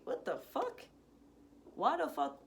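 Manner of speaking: high, exasperated bewilderment; baffled disbelief. Retold or imitated. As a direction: react baffled to an unwelcome arrival. Baffled at assistant showing up.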